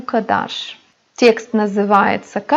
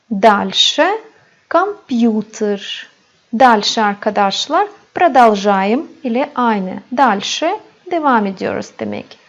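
ordinary delivery

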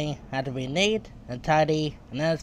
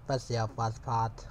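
low, soft throwaway nickname; quiet aside; 4 semitones lower, 7 dB quieter than usual. A narrator's reading. Narrator repeats the nickname to herself, quiet aside. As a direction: drop it in under your breath.

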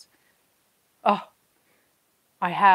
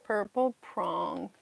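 mock chat voice; 9 dB quieter, slower than usual. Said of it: "y'all are gonna be like".

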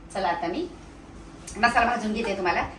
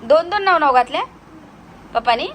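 playful amazement; 8 semitones higher, 8 dB louder than usual. Real, not acted. Echoing toddler with mock amazement. (लाडिक आश्चर्याने, उंच आवाजात)

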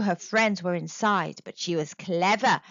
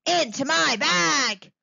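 higher, triumphant monster cry; monster shout; 6 semitones higher, 4 dB louder than usual. A narrator's reading. Monster bagging strong Panther, shouting. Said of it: "shouted the monster".

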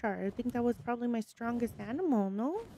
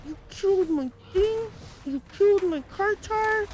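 anguished wail; 4 semitones higher, 4 dB louder than usual. Real, not acted. Mourning her killed creature.

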